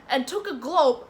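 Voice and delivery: ordinary talk, nothing marked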